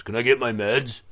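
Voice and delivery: a hint of an entitled inmate, lightly voiced; mild mock inmate voice; pushy inmate. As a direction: toss off the request like he's owed it. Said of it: "some other motherfucker walks up and goes".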